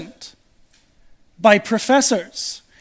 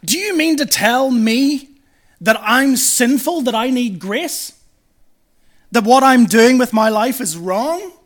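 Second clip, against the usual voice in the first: acted angry challenge, loud and high; imitating indignant anger, emphatic phrase by phrase; getting angry; 5 semitones higher, 7 dB louder than usual. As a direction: throw it back as an outraged question. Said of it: "they're really getting angry because they're saying".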